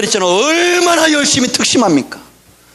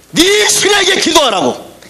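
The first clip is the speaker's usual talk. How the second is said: urgent plea; 6 semitones higher, slower than usual. Retold or imitated. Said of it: "흔들려 깨우면서".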